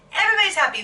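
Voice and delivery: ordinary talk, nothing marked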